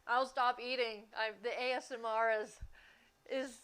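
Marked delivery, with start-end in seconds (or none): 3.3-3.6 laughing. Real, not acted. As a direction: talk through real giggles. Joking about chewing noise, laughing.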